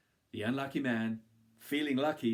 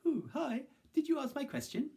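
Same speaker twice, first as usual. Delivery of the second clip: much higher, eager tree voice; 3 dB louder than usual. Acted. The tree greets the man, high and eager. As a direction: voice the tree as a hopeful creature.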